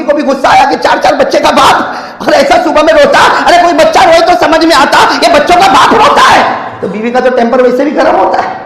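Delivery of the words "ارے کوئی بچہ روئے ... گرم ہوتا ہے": ordinary delivery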